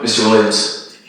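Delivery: low, soft, teasing doctor voice; seductive doctor. Retold or imitated.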